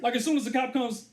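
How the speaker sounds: ordinary talk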